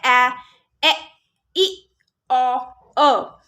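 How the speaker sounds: short vowels said one by one, clipped; short vowel sounds. Shown as a demonstration. Teacher modelling short vowels for parents, clipped and clear. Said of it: "first sound जो short sound होता है".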